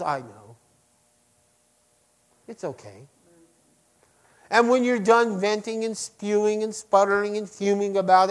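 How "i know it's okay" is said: gentle comfort. Acted. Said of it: "And he just says".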